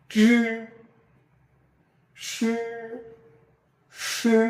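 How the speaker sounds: জি and সে drawn out with a push of air; throaty pinyin sounds. Shown as a demonstration. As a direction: hold জি and সে long, pushed from the throat. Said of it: "গলা থেকে আমরা বের করব জোর দিয়ে".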